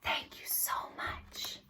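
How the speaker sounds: whispered thanks, barely there. Shown as a demonstration. Storyteller thanking the quiet grown-ups in a whisper. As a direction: whisper it so nobody is disturbed. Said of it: "to be extra quiet".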